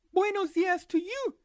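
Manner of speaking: put-on high girl's voice; little girl voice.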